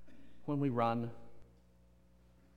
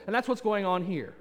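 normal talking voice

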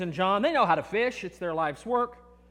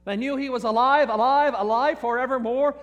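rising preacher's proclamation, a little louder; preaching; 3 semitones higher, 4 dB louder than usual. Acted. Preacher proclaiming to congregation that Jesus lives, building. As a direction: lift it like a sermon's climax.

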